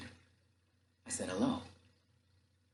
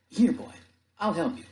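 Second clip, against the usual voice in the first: high, loud offer of help; raised, urgent helping voice; sailor calling out; 9 semitones higher, 5 dB louder than usual. Acted. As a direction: call over to the boy helpfully. Sailor calls to rescued boy.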